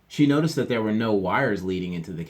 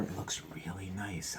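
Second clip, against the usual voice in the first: whisper; 11 dB quieter than usual. Real, not acted. Aside to listeners.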